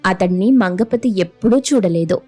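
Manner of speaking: plain reading voice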